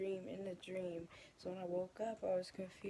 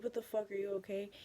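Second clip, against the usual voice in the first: alarmed; 2 semitones higher, 8 dB louder than usual. Retold or imitated. Alarmed at friend bitten by snakes.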